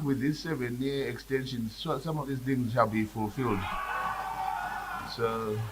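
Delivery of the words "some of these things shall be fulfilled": ordinary talk, nothing marked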